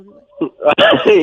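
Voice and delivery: drawn-out loud finish. Acted. Prank caller ends the joke.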